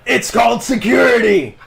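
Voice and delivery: high, loud defensive shout; yelled retort. Acted. Greer defending his huge key ring, high shouted retort. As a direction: holler back in self-defence.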